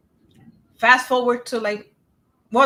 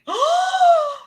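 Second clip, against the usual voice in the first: sharp gasp; 5 dB louder than usual. Retold or imitated. Shocked at pregnancy length.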